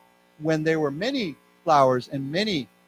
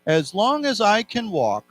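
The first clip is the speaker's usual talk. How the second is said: slow, measured phrase, slightly raised; read-along; 4 semitones higher than usual. Shown as a demonstration. Teacher leading students through a quote, slow read-along. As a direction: read it out slowly for learners to repeat. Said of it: "So let's read this together".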